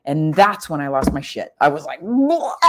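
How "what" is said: real shock. Real, not acted. Learning her mom died.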